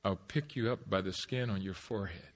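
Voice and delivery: low, soft threatening line; quiet menace. Retold or imitated. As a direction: threaten him through a quiet voice. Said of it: "this tone in her voice".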